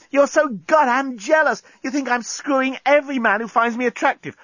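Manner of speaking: very high, furious female voice; narrator's shrieky angry Lucy; mad at him. A narrator's reading. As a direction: lash out in a high-pitched rant. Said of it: "Lucy was getting mad at him".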